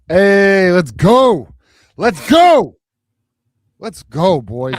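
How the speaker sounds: loud, high celebratory shouts; excited cheering. Real, not acted. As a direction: cheer it like your team just won. Celebrating championship win on air.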